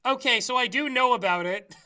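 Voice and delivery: ordinary talk, nothing marked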